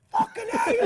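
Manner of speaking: high, loud, delighted yell; ecstatic shout. Retold or imitated. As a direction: whoop it out with joy. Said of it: "he turns back, and he's like".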